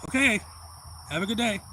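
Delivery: mother sending him off after the fight; mock mom voice. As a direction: chirp it brightly as mother. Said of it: "my mom's like".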